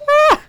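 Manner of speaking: sudden high loud scream; panicked scream. Real, not acted. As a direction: shriek in real fright. Scream while fleeing.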